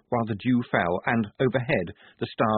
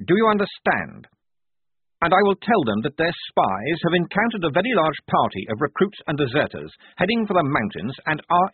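stern lecture; 7 semitones higher, 4 dB louder than usual. Acted. Officer lecturing insubordinate sergeant.

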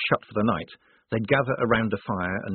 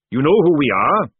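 loud, booming fat sergeant; hearty sergeant; 6 semitones higher, 9 dB louder than usual. Acted. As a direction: boom it like a jovial recruiter.

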